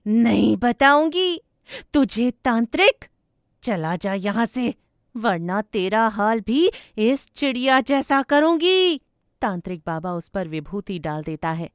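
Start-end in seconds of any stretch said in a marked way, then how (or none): 0.1-9.0 loud threatening refusal; defiant ghost. Acted. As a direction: dare the exorcist, building to a raised threat.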